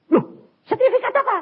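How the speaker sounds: high, loud startled question; preacher playing his own startled self; surprised self. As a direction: act amazed at the gift. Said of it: "Tuhan ngomong gini".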